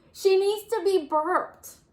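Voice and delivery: high, loud slow yell. Acted. Nora yelling to Bear over the crying baby. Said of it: "Nora yelled above the".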